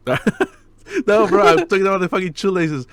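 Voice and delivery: laughing, half-shouting correction; exasperated laugh. Real, not acted. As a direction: protest through a laugh. Correcting the drugs misunderstanding.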